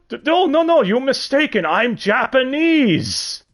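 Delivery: high, put-on fake Japanese accent, slowing hard on the last line; silly fake Japanese accent. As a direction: ham up a bad fake Japanese accent, denying it. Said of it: "with a really silly as fuck accent".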